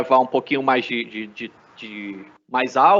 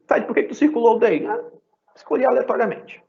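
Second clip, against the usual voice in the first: mock student question; 9 semitones higher, faster than usual. Voices imagined student question, answers.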